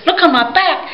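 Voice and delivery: high, excited cry of surprise; high character voice. Acted. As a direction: voice jumps way up, pitched high and lively. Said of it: "he says".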